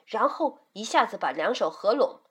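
usual speaking voice

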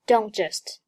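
"don't just" with no released t in "don't"; dropped t. Shown as a demonstration. Teacher shows 失去爆破, "don't just" with silent t. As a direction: run "don't" into "just" without popping the t. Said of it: "don't爆破音t失去爆破".

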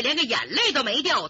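usual speaking voice

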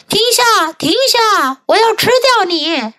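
high-pitched pig's call; crying out. A narrator's reading. Hungry pig calls to the runaway, high-pitched cry. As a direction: call out high to halt him. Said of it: "猪大喊道".

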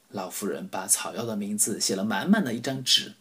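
ordinary delivery, nothing marked

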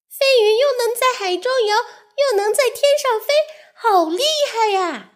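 gasping in wonder at the fish; marvelling. Acted. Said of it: "小鱼感叹着". (惊叹不已，又高又快)

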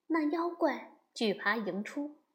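usual speaking voice

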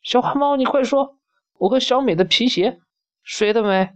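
put-on very high and fast, an impatient angry child; hurried and fierce. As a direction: rush it out, fierce and demanding. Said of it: "声音又急又凶".

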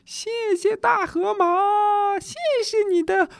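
very high, slow, delighted thanks; happy little animals. Acted. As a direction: sing out your thanks like tiny happy animals. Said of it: "高兴地说".

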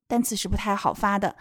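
usual speaking voice

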